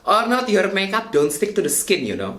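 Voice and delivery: big-city Indonesian street-slang English; Javanese-mixed Indonesian accent. Shown as a demonstration. Vlogger teaching viewers about primer, Javanese-mixed Indonesian accent. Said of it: "the big city Javanese mixed accent".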